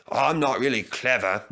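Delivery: usual speaking voice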